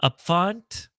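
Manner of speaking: tentative "up font", first vowel as in "cup"; guessing a pronunciation. Shown as a demonstration. Streamer trying another font name guess, questioning "up font". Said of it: "not sure how they pronounce it".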